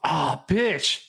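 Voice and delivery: fed-up groan ah, bitch; missed train. Shown as a demonstration. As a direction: sigh out ah, bitch in frustration. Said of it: "you miss your train".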